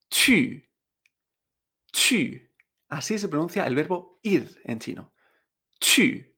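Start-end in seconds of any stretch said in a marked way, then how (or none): 0.1-2.4 chu with French Ü, pitch falling sharply; cuarto tono. Shown as a demonstration. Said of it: "con cuarto tono". 5.8-6.2 Chu with French Ü and a falling pitch; falling fourth-tone "chü" for Chu; cuarto tono. Shown as a demonstration. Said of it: "con cuarto tono".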